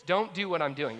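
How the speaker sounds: ordinary talk, nothing marked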